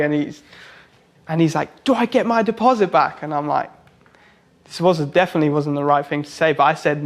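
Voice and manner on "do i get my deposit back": high, strained imitation of a worked-up customer; agitated client. Client demanding money back from the tattooist, high and agitated. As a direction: pitch the question up in mock agitation.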